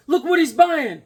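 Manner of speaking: high, loud mocking call; mocking clerk. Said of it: "He goes".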